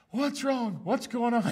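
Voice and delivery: mock alarmed parents at the door; worried parents. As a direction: ask like a startled parent. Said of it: "And they're like".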